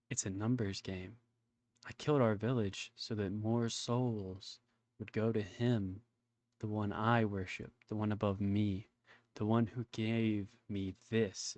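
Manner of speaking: slow, low villain confession. Acted. Villain explains why he killed the village, slow and low.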